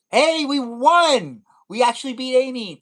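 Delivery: real, loud, high cheer; slow, stretched victory whoop; excited win. Real, not acted. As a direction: shout it out, thrilled at winning. Winning the battle.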